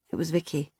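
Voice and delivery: ordinary delivery, nothing marked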